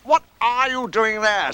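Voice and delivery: high, drawn-out questioning; high-pitched, emphatic inquiry; Rabbit. Acted. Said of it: "said Rabbit".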